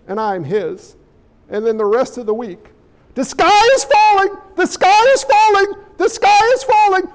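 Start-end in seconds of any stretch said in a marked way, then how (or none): 3.1-7.1 high, loud frantic alarm; hammed-up panicked shouting, pitched high; panicky Chicken Little. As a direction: cry doom like Chicken Little. Mocking fearmongering media.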